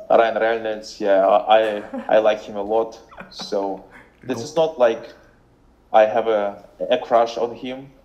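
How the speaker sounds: thick Russian accent with rolled R's; regular Russian-dude accent. Shown as a demonstration. Showing a friend how a regular Russian sounds, thick Russian accent. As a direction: put on a heavy Russian accent, chatting away. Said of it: "just a Russian dude".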